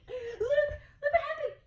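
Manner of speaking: high, slow words through real laughter; laughing as you point to the page; laughing delight. Real, not acted. Laughing at the toddler's roar.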